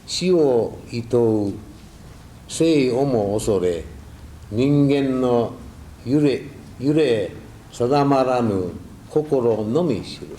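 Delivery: slow, measured verse reading; poem recitation. Acted. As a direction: read each line slowly like a poem. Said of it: "これは最近私が読んだのなんですがね".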